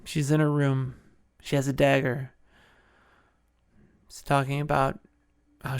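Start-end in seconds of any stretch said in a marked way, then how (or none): none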